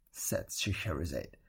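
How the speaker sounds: ordinary delivery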